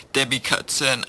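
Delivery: ordinary delivery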